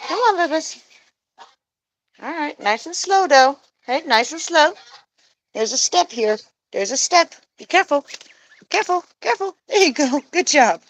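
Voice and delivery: high sing-song encouragement; high coaxing. Real, not acted. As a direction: coax the babies along carefully. Guiding geese over step.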